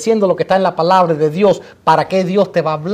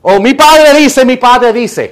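high, loud declaration, easing on the repeat; Jesús; 4 semitones higher, 4 dB louder, slower than usual. Acted. Jesus citing his authority to the devil, loud declaration. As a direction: declare it slowly with force, then ease off. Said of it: "Jesús podría haber dicho".

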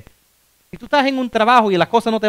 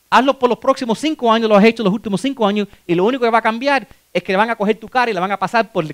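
ordinary talk

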